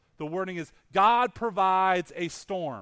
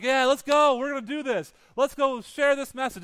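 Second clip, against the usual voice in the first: mock eager rallying cry, higher pitch; excited Jonah; 6 semitones higher, 5 dB louder than usual. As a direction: play an over-keen Jonah raring to go. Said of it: "Jonah's all excited now".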